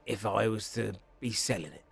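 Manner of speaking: soft, low-key dealer, guarded; quiet, measured shopkeeper picking words carefully; shady shopkeeper. Acted. Shopkeeper hinting that the goods may be stolen, hushed and careful.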